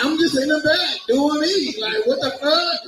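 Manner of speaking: ordinary delivery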